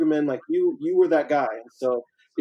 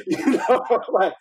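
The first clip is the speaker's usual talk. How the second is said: laughing; 8 semitones higher than usual. Real, not acted. Laughing at own irony.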